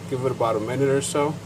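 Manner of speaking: ordinary delivery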